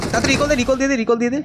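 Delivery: excited high voice, rushing; urgent high-pitched pleading. Real, not acted. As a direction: hurry them to recall. Urging teammate to recall.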